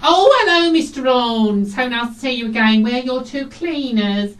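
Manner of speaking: high, loud camp greeting; camp character. Acted. Two camp cleaners greeting a client at his door, camp and effusive. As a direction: sing out a gushing hello, drawing out the end. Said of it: "and camp characters".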